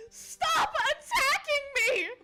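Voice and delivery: usual speaking voice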